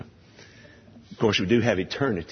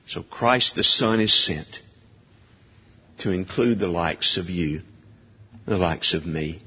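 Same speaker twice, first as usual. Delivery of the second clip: ordinary delivery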